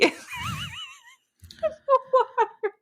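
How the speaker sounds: high, slow words squeezed out through laughter; laughing. Real, not acted. Mocking the book's swan finale, laughing high.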